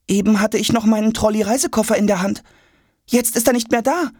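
put-on high, hurried boy's voice; alarmed boy. A narrator's reading. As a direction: blurt it out high as a worried child. Boy notices his suitcase is gone. (aufgeregter Junge, hoch)

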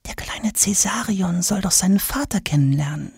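secretive whisper behind a hand. Acted. Court lady whispering gossip to Tom. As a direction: let them in on a secret, whispered. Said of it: "flüstert hinter vorgehaltener Hand".

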